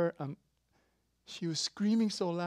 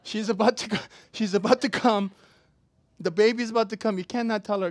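louder and higher, pushing hard; urgent emphasis; 5 semitones higher, 10 dB louder than usual. Acted. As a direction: drive it home with urgency. Preacher stresses unstoppable birth.

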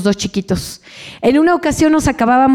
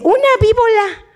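put-on high little-girl voice with a childish lisp; small child; 9 semitones higher than usual. Little niece naming her drawing, high and childish. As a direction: say it like a toddler.

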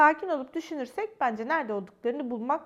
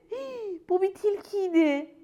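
high, slow, whiny fox voice starting to cry; tearful high wail about a bitten nose; 8 semitones higher, 7 dB louder, slower than usual. Acted. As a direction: blubber it like a hurt little fox. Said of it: "diye ağlamaya başladı".

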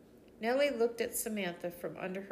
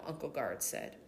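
plain reading voice

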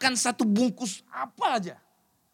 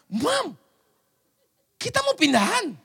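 1 mock amazement; 10 semitones higher, 5 dB louder than usual. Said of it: "saya pas buka".

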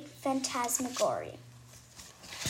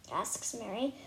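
ordinary delivery, nothing marked

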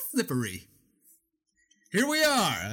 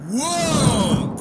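loud high whoa; excited exclamation; 6 semitones higher, 8 dB louder than usual. Acted. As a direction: burst in loudly. Spirit bursting in.

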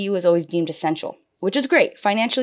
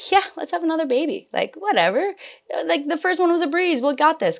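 high, breezy carefree past self; mock-confident, airy and high; 10 semitones higher than usual. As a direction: toss it off like nothing could go wrong. Retelling her carefree past self.

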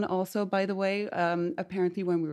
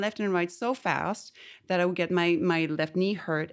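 ordinary talk, nothing marked

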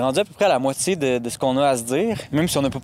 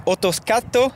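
slow, higher Italian accent; 7 semitones higher, slower than usual. Shown as a demonstration. Game host revealing the answer, Italian accent. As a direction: say the word slowly in Italian. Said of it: "mon meilleur accent italien".

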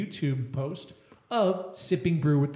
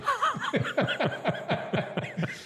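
high laugh; 11 semitones higher than usual. Real, not acted. Laughs at shtick joke.

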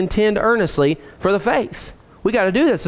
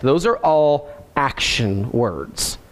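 low, very slow and deliberate; slow, lower-pitched dictation; slowed for note-takers; 7 semitones lower, much slower than usual. Real, not acted. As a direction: draw out each word for people taking notes. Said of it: "I'm slowing down for the note takers".

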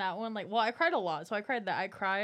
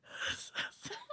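airy, very high giggle; a squeaky breathless laugh; breathy high laugh; 7 dB quieter than usual. Real, not acted. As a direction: laugh it out silently. Laugh remembering sobbing.

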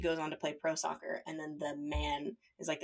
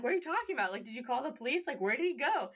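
alarmed daughter; 6 semitones higher than usual. Retold or imitated. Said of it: "the daughter's like".

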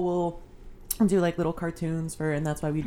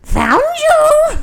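high, loud, shrieking creature voice; weird monster voice; 18 dB louder than usual. Acted. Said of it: "weird monster voice".

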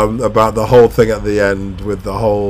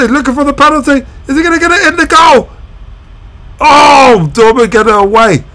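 high, loud, racing play-by-play; breathless fan calling a crazy finish; excited live commentary; 12 semitones higher, 5 dB louder than usual. Real, not acted. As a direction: call the final minutes like the goal just went in. Live reaction to late goal.

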